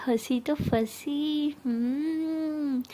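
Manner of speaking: very slow, drawn-out singsong tease; playful teasing. Acted. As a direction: stretch it out knowingly with a long hum. Siya teases Tara for laughing. (छेड़ते हुए, खींचकर)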